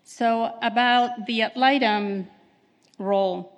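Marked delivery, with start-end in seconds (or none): none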